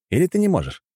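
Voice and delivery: ordinary delivery, nothing marked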